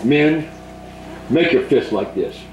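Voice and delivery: ordinary talk, nothing marked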